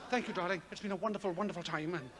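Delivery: low, soft, restrained romance; polite film lover. Retold or imitated. British film lover says a soft, restrained goodbye to his love. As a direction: say goodbye like a buttoned-up film lead. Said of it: "they say".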